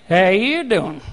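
low slow flirty "How you doing?"; smooth lady's-man drawl; Joey Tribbiani pickup line. Retold or imitated. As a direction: drop deep and flirt. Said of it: "There's an emphasis on the".

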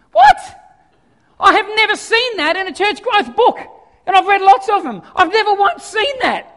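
loud, high-pitched comic disbelief; mock incredulity. Preacher amazed at Jesus' church growth method, mock incredulity. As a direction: exclaim as if utterly astonished.

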